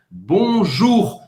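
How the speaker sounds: bonjour with the guttural French R at the end; bonjour, clear French vowels and a back-of-the-throat R; model pronunciation. Shown as a demonstration. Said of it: "Então vamos lá".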